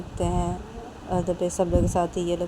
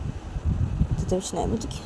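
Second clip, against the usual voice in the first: usual speaking voice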